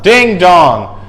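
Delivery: usual speaking voice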